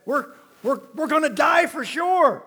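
high, loud frightened outburst; hammed-up panic; panicky shout. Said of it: "Manoah said".

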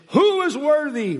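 high, loud, ringing cry; booming loud voice. Acted. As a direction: cry it out like a herald. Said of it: "with a booming loud voice".

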